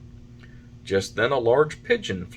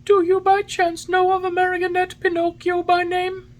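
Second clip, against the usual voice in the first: very high, louder bird voice; pigeon voice; 6 dB louder than usual. A narrator's reading. Pigeon asks after a marionette named Pinocchio, in pigeon voice. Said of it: "said the pigeon".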